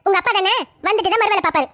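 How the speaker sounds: ordinary talk, nothing marked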